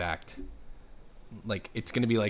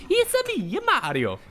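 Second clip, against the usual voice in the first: put-on high squeaky Italian plumber accent; Mario voice; 5 dB louder than usual. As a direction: chirp it like a cartoon Italian plumber. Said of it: "if he starts going".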